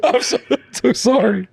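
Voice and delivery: high squeaky apology through laughter; laughing mock apology. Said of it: "laughing mock repeat".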